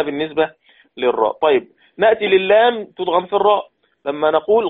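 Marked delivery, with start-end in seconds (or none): none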